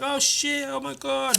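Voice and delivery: high, hushed mock panic; shocked crowd. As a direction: play onlookers gasping in horror. Voicing horrified crowd.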